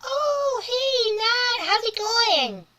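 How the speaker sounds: very high, loud cheery hello; bright greeting. Real, not acted. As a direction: call out a sing-song hello. Greeting someone arriving.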